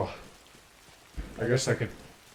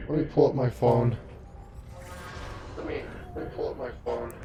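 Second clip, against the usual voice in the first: normal talking voice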